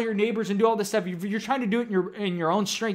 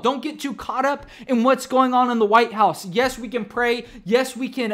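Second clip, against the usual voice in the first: raised, driving sermon voice; fervent preaching; 4 semitones higher, 3 dB louder than usual. Acted. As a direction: preach it out to the congregation, pressing hard. Preaching with rising force.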